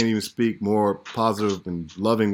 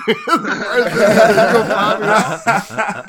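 slow, higher, laughed through; laughing dad tease; 9 semitones higher, 8 dB louder, much slower than usual. Retold or imitated. Dad pressing his son for a trip, laughing. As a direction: beg for a plane ticket while laughing. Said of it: "dad asking again".

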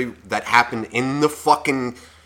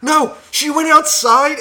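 surprised twist; 11 semitones higher, slower than usual. Real, not acted. Twist in the story.

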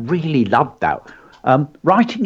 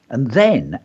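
normal talking voice

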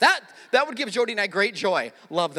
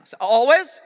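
louder, higher call, pushing the crowd; louder call to repeat; 3 semitones higher, 10 dB louder than usual. Shown as a demonstration. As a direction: call out again, louder, demanding the echo. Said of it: "no, no".

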